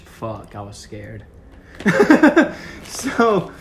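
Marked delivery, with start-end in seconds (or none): 1.3-2.8 loud high laugh. Real, not acted. Host laughs at co-host.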